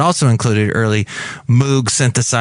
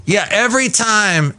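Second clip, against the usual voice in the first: usual speaking voice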